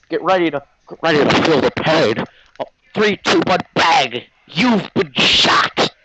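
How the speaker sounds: hammed-up showdown, stretching out the last words; injured-deer inflection. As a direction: imitate a wounded animal's strained countdown. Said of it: "the infliction of an injured deer".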